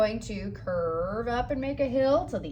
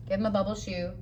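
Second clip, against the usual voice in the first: whispered aside; 5 dB quieter, faster than usual. Real, not acted. Whispered aside while drawing.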